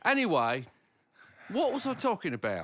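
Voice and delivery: high, loud mock-British exclaiming; hammed-up English accent. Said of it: "your sexy British accent".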